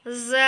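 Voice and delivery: ज़ buzzed through closed teeth; pressed buzzing letter. Shown as a demonstration. Teacher showing tongue between closed teeth, pressed buzz. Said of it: "पढ़ना है ज़ोर से".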